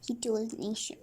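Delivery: ordinary delivery, nothing marked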